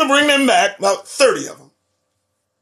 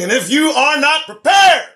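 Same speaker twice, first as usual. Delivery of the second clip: higher and louder, a sudden warning shout; urgent shout; 8 semitones higher, 7 dB louder than usual. Real, not acted. Commentator warning listeners, sudden urgent shout. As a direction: shout the warning straight at them.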